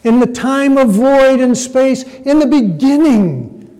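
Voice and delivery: high, loud preaching voice; emphatic preacher. Acted. Preacher stressing the beginning of the verse, high and forceful. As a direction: preach it big, pitched high, phrase after phrase. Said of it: "And they say".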